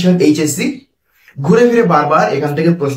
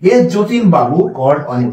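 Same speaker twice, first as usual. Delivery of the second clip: slow dictation; slower than usual. Shown as a demonstration. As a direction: read the example sentence word by word. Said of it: "এই যে called on preposition".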